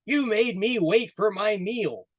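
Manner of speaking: loud, high angry roar of a lion; furious lion. Acted. Lion scolding the late rabbit, furious and loud. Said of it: "The lion was furious".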